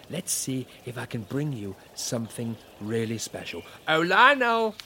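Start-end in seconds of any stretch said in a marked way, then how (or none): 3.9-4.7 high, loud call across the garden. Acted. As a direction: call out to someone far off. Calling out to someone.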